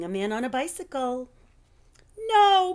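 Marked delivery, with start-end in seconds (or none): 2.3-2.7 sharp high cry of no; croaked no. A narrator's reading. Phoebe croaking at the sight of Mister Seedplot. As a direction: blurt it in alarm. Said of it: "croaked Phoebe".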